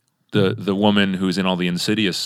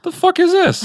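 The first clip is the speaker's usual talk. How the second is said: sudden much higher, louder New York snap; East Coast tough guy; 6 dB louder than usual. Retold or imitated. Dad imitated being East Coasty, mock New York voice. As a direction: toss it off like a Brooklyn dad mocking. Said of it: "being East Coasty at me".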